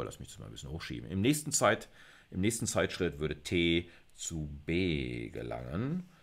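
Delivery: ordinary talk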